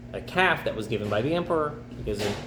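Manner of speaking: usual speaking voice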